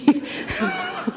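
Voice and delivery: real high-pitched laughing fit; helpless squeal of laughter. Real, not acted. Speaker amused at what she forgot to ask, high laughing. Laughs at her own oversight.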